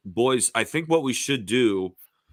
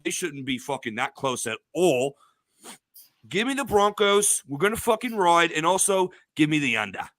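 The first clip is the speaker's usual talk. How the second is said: put-on British accent; 3 semitones higher than usual. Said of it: "Joseph and I can talk a British accents".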